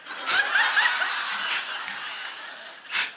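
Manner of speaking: high airy laugh that trails off; real laugh at her own joke; breathless rising laugh; long breathy laugh. Real, not acted.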